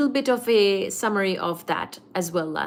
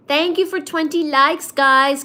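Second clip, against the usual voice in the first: excited; 6 semitones higher, 6 dB louder than usual. Real, not acted. Excited thanks to viewers.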